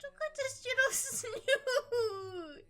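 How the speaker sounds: high, stretched squeal over something adorable; real overwhelmed cooing; about-to-cry delight. Real, not acted. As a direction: gush as if close to happy tears. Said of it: "I'm gonna cry. It's so cute".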